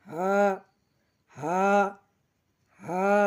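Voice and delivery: breath-led "হা" from the start of the throat; top-of-throat sound. Shown as a demonstration. Teacher showing learners the letter, "হা" from the throat. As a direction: let "হা" come straight from the throat. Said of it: "হলকের শুরু হইতে".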